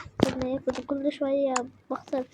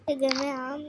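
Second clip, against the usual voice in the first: ordinary talk, nothing marked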